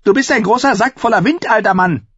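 put-on a raider woman taunting the old wildling; hissing Harma. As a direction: spit the insult in a high, strange voice. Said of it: "fauchte Harmer".

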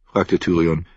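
ordinary delivery

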